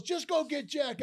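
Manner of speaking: high, loud imitated order; impatient boss's mock-angry command; relentless. Boss sending manager for Jack, relentless. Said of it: "he was relentless".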